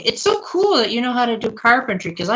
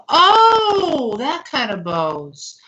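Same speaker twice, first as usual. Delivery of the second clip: surprised realization; 6 semitones higher, much slower than usual. Real, not acted. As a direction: let the penny drop with a gasp. Finally understands which bows are meant.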